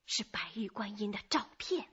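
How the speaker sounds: hushed, secretive whisper; confiding whisper. Acted. Neighbour whispers the secret to the detective.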